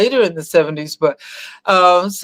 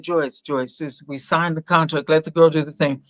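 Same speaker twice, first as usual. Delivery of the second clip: soft pleading dad; 5 semitones lower, 5 dB quieter than usual. Retold or imitated. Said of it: "Dad was saying".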